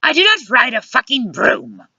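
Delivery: high-pitched offended denial; indignant interjection. A narrator's reading. Luciana interjects about the broom, indignant. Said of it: "Luciana interjected".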